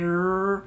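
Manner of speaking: sustained English "rrr"; long isolated retroflex "rrr". Shown as a demonstration. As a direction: curl and hold the R sound. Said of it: "sempre é retroflexo".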